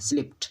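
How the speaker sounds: स्लिप्ड ending in a voiceless T; correct past ending. Shown as a demonstration. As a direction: say स्लिप्ड as "slipt". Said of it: "स्लिप्ड पढ़ते हैं". (स्लिप्ड के अंत में ट की आवाज़)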